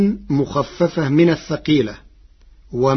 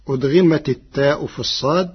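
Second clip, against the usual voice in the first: usual speaking voice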